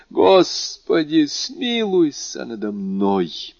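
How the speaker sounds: high, slow plea falling away; anguished exclamation. A narrator's reading. Dying man begging God, crying out in anguish. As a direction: cry for mercy, stretching the words. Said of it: "воскликнул несчастный".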